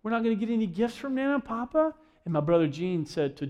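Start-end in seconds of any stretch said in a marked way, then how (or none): 0.0-1.9 mildly mock disappointment, questioning at the end; disappointed child. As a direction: imitate a let-down little niece. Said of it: "she was kinda disappointed".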